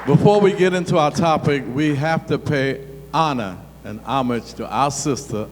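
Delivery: ordinary talk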